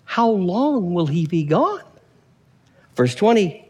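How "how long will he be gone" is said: slow, drawn-out nagging alert; lightly voiced pager alert, stretched out; beeper's warning message. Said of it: "beep, it goes off again".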